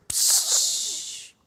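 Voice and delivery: a quick hiss like air leaving a balloon; hissing balloon sound; deflating hiss. Shown as a demonstration. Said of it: "Like a balloon".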